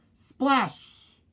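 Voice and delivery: high, falling Splash!; Splash! called high and falling; splash sound. A narrator's reading. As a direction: call out the splash like a sound effect. Sound effect word in story.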